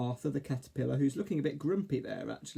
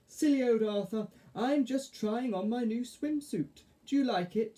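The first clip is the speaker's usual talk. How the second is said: high, slightly slowed fairy voice; Mavis; 8 semitones higher than usual. A narrator's reading. Fairy shows caterpillar her new swimsuit, high voice. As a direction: speak high, showing off.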